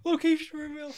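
high, hammed-up alarm call; mock alarm call. A co-host mock-warns about revealing a location, high and hammed-up. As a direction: shout it like a game-show buzzer. Repeats call, much higher.

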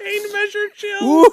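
high, slow disbelieving echo; incredulous. Real, not acted. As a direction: stretch it out in disbelief.

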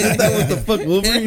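high laughing question. Real, not acted. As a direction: laugh while asking. Laughing at friend's joke.

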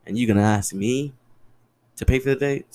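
ordinary delivery

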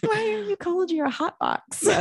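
hammed-up fretting partner; mock concerned partner. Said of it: "My concerned partner".

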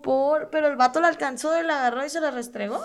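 high, loud outrage; outraged. Real, not acted. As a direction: spit it out, outraged. Outraged retelling of assault.

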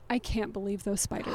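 low, soft disbelieving murmur; mom's stunned murmur. Retold or imitated. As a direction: mutter it in quiet shock. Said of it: "just kept saying".

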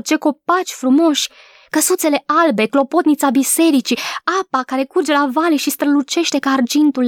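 put-on high, delighted child's exclamation; a girl thrilled by the beautiful landscape; joyful cry. A narrator's reading. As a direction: gush with delight at the view. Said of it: "strigă veselă".

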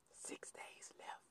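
slow, hushed whisper. Acted. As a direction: breathe it out as a secret. Countdown said in a whisper.

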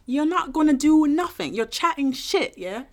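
high, hammed-up British taunt; mock British accent. Host taunting British listeners, mock British accent. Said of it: "better American accent than we do, do British".

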